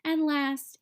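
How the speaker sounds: usual speaking voice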